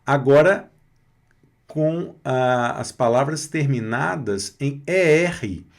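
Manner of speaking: usual speaking voice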